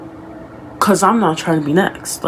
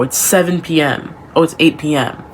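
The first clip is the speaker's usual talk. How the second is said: ordinary talk, nothing marked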